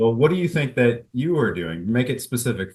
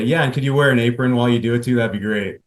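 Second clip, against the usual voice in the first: fast, louder teasing quip; joking comeback; 3 semitones higher, 7 dB louder, faster than usual. Shown as a demonstration. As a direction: rattle off the joke lightly. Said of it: "when I make a joke".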